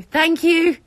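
high, loud thank-you; shouted thanks. Real, not acted. Streamer calling thanks to the neighbour, shouting. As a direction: call it out to someone in another house.

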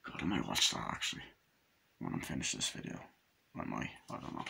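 very low, hushed musing; muttered aside. Real, not acted. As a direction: think aloud under your breath.

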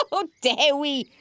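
high laugh into the words; laugh, then say it still amused; acted story-time chuckle; burst of laughter. A narrator's reading. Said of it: "burst into laughter".

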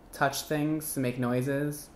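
ordinary talk

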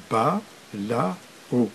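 BA, LA, O said apart, softly; syllable by syllable. Shown as a demonstration. Teacher showing learners syllabic reading, syllable by syllable. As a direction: sound out BA, LA, O one by one. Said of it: "tout en décomposant d'abord".

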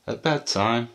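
usual speaking voice

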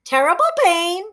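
high shrieking parrot voice. Acted. Parrot Che shrieking back the boy's words. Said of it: "shrieked Che".

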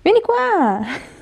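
high, slow sing-song call; talking to a dog. Real, not acted. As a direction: drawl it to lure the dog. Calling the dog.

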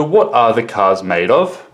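teacher's model question, climbing on MADE OF; rising question intonation. Shown as a demonstration. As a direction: voice lifts on MADE OF at the end. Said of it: "it goes up".

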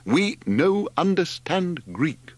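high, slow, drawn-out call; yelling. A narrator's reading. Margot to the gesticulating drivers, slow high yell. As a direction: spell it out slowly in a high yell. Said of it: "she yelled at the gesticulating drivers".

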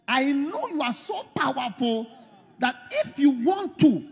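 high-pitched, insistent appeal to God; emphatic mock prayer. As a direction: act out a bold, candid plea. Models a bold prayer to God.

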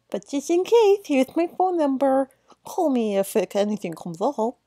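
a made-up voice for the game character's lines; odd NPC voice. Acted. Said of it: "I don't know what voice that was".

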